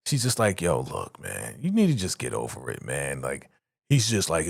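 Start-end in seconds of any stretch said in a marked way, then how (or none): 0.6-3.2 lower, softer, offhand; a hint of Kimmy brushing him off; lower dismissive voice.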